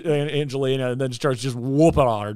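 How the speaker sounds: ordinary talk, nothing marked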